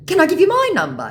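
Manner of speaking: high, loud, quick question; eager mock scammer. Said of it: "he asks".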